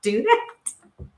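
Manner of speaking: high, slow amazed laughing question; giddy high disbelief. Retold or imitated. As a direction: act stunned and amused. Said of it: "I was like".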